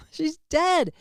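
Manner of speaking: ordinary delivery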